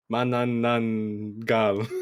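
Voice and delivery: slowly sounding out Mananangal. Shown as a demonstration. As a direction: spell out Mananangal syllable by syllable. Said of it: "There's a lot of names".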